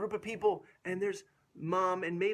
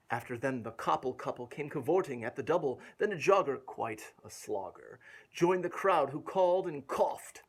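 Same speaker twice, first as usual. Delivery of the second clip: put-on lilting read-aloud rhythm for a child; rhymed story reading; 2 semitones lower, 4 dB louder than usual. A narrator's reading. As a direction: bounce through the rhyming verse. Rhyming verse continues.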